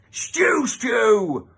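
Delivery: high, loud roared stew; thundering. Acted. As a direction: roar it across the hall. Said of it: "thundered Splat".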